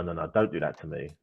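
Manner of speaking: usual speaking voice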